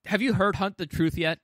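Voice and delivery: ordinary talk, nothing marked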